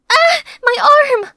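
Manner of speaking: sharp high scream of sudden pain. Acted. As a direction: cry out as the arm is struck. Joseph hurts her arm.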